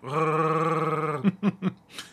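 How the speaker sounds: long gargled creature growl; rolling, gargling throat trill; Chewbacca. Shown as a demonstration. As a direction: gargle air in a sustained wookiee roar. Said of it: "I can kinda do Chewbacca".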